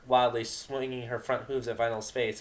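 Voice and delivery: plain reading voice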